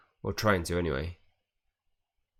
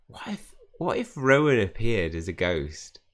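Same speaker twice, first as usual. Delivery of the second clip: retold sudden idea; 4 semitones higher, 6 dB louder, slower than usual. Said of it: "And I thought".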